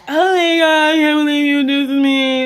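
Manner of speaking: hammed-up high, loud wail; mock hysterical crying. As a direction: imitate someone wailing in betrayal, pitched high. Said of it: "starts crying hysterically".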